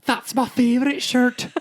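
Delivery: high, loud Irish accent impression; mock Irish accent, high and drawn out. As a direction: do Kendra in a broad Irish accent, last words slow. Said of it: "in my Irish accent".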